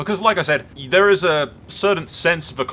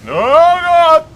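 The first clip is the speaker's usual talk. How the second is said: shocked yell; 12 dB louder than usual. Real, not acted. Ship crashes suddenly.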